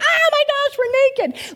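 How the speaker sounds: the man pretending to be shocked; mock shock. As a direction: play a hammed-up sudden shock. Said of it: "you're shocked by the whole thing".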